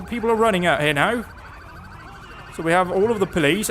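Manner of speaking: ordinary talk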